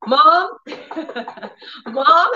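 a lightly voiced small child calling; high little call through a door; little girl's voice. Daughter knocking at the bathroom door, lightly childlike. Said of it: "I heard a little voice".

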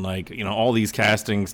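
ordinary talk, nothing marked

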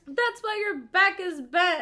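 hammed-up bickering grandma; cranky old lady. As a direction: scold like an old woman at dinner. Imitating elderly friend bickering.

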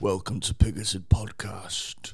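low, strained Bane whisper; whispered Bane impression. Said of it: "You sound like you're straining out a poo".

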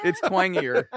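teasing laugh breaking through the words; laughing while teasing. Real, not acted. Friend ribbing a friend about his twang, laughing.

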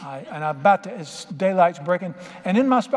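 ordinary delivery

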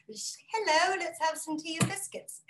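put-on English grandma accent. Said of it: "my grandma still has her accent".

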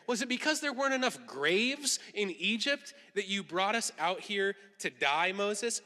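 acted sarcastic questioning. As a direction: lay on the sarcasm, voice lifted. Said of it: "so hear the sarcasm here".

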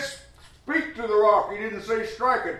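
high, loud, insistent retelling; preacher stressing which order God gave, high and loud; emphatic exclaim. Acted. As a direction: cry it out high to drive the point home. Preacher exclaiming God's exact command.

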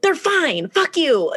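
high, huffy retort; acted exasperation. Acted quarrel over the dogs.

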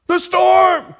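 high, loud blurted answer; mock yell. A disciple blurting back at Jesus, high and loud. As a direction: shout the obvious.

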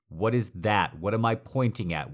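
ordinary talk

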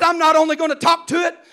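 put-on a kid puffing himself up brave; defiant boast. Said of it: "I said".

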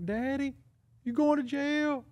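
put-on high, slow child's voice; little son. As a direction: play the scared little boy in the back seat.

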